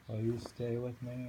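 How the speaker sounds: normal talking voice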